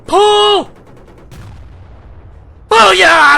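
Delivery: skeet call. Real, not acted. Gamer shooting down a plane like skeet, shouted call.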